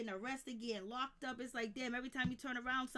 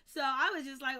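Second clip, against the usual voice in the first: normal talking voice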